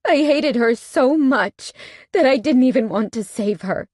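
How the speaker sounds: high, loud, near tears; anguished confession. A narrator's reading. Heroine confessing her guilt to the bikers, anguished.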